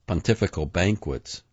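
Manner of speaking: ordinary delivery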